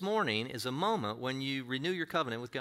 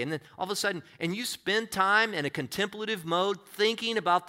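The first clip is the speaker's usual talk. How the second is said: loud, high preaching build-up; fiery sermon climax; preaching at full force; 6 semitones higher, 8 dB louder than usual. Acted. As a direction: preach it out, rising to a cry.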